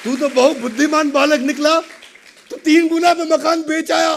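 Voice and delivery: high, loud joyful praise; delighted father. Acted. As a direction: crow with acted delight at the son. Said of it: "पिता बहुत खुश हुए".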